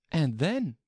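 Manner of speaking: put-on high, light girlish voice; young girl voice. Alice thinking aloud.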